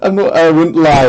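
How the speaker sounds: laughing hard. Real, not acted. Laughing after the attempts.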